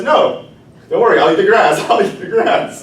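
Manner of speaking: quick eager promise; eager goat. Acted.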